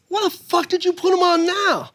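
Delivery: high-pitched indignant disbelief; Rudy Rush. Retold or imitated. Host asking backstage about the unknown comic, high indignant impression. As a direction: squawk it high like an affronted host. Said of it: "He goes".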